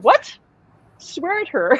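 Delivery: high incredulous echo; retold disbelief. Medium reacting to Alan's advice, mock disbelief. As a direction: throw the advice back as a startled question.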